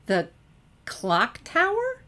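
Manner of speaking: high, slow incredulous echo; parroted in surprise. Acted. Twilight echoes Sunset's words, high and incredulous. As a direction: echo it back, baffled. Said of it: "Twilight parroted".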